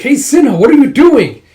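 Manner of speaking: high, loud scolding shout; indignant yell. Retold or imitated. Yun catches Sinha slashing the tent, indignant high yell. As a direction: yell at him in mock outrage.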